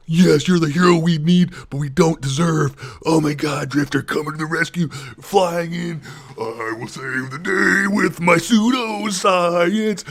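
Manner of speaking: high, hammed-up superhero savior; mocking announcer of a hero swooping in; mock heroic voice. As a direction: lay the sarcasm on thick like a cartoon hero.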